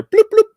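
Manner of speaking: high little bloop noises; phone alert imitation. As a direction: imitate a phone's message chime.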